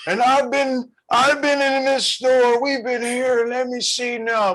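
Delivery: put-on a weary eighty-year-old drawing it out; lightly played old man stuck shopping; old man in the store. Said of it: "He said".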